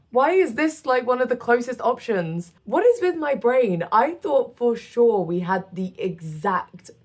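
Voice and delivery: mildly upset with yourself, pitch jumping up; upset, exasperated. Real, not acted. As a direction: complain in baffled dismay at your own mistake. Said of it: "Now I've upset myself".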